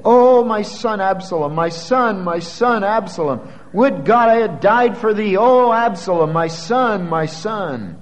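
keen it out like a father who just lost his son; preacher voicing David's anguished weeping, fading at the end; wailing lament. A narrator's reading. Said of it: "and wept".

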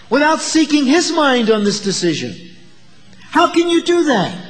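high-pitched, forceful challenge from the pulpit; remonstrating. Acted. Preacher voicing James confronting people who plan without God, remonstrating. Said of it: "James is saying to people who hadn't done that".

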